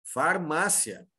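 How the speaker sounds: slow "Farmácia", stressed on MA, far-MA-sya; stressed syllable shown. Shown as a demonstration. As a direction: draw out "Farmácia" to show MA as the strong syllable. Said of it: "Minha sílaba tônica é essa aqui". ("Farmácia" devagar, com tônica no MA)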